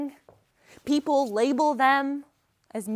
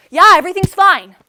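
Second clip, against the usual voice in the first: loud, higher, over-emphatic brush-off; insistent reassurance; 7 semitones higher, 15 dB louder than usual. Acted. Daughter hiding trouble from worried mom, loudly insistent. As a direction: insist loudly to end the questioning.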